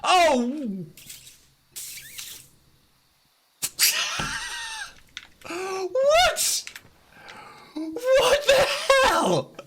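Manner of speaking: high, loud shocked yelling; shocked shout. Real, not acted. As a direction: yell out in disbelief. Sudden illusory wall shock.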